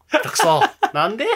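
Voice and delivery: retold gamer outburst. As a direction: snap at a clumsy teammate. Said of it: "声に出しても". (下手な味方に思わず怒鳴る)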